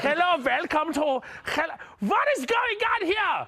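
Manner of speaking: high-pitched mock German accent. Comedian welcoming then questioning, mock German accent. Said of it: "Yes, Germany".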